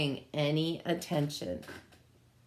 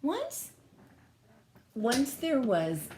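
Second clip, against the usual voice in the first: storybook opening; 7 semitones higher, slower than usual. A narrator's reading.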